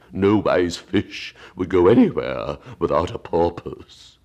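slow, melancholy turtle voice; Mock Turtle voice. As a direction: drag it out like a sad old turtle.

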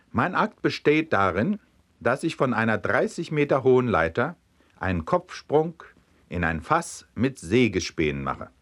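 plain reading voice

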